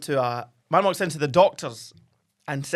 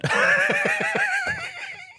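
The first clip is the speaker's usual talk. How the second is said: high shrieking laugh. Real, not acted. Burst of laughter.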